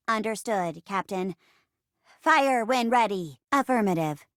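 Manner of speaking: even computer acknowledgement; robot assistant. Acted. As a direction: confirm like a ship's computer, evenly paced. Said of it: "I'm your robot assistant".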